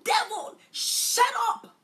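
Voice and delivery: loud, very high scream at the devil; piercingly high, furious rebuke; full-voiced scream. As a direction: scream it at the top of your voice. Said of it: "I screamed".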